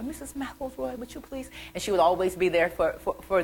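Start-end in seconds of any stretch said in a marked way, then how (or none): 0.0-1.5 high, hushed polite request; soft, sweet-pitched plea from a nun; nuns calling politely. Retold or imitated. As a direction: ask very softly, up high. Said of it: "the nuns used to always call her".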